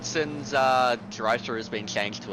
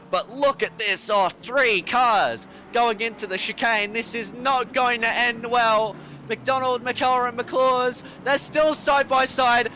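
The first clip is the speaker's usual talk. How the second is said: excited, higher and louder play-by-play; motorsport commentator; 11 semitones higher, 4 dB louder than usual. Real, not acted. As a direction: call the chicane battle live and rising. Live call of three-car battle.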